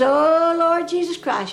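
hammed-up desperate prayer; mock prayer cry. [name] praying loudly on raft.

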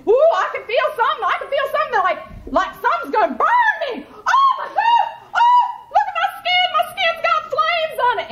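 high, loud, fast mock panic with laughter; panicking Gator. Gator realizes his skin is burning, hammed-up panicked shriek. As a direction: shriek it out as the skin catches fire, half laughing.

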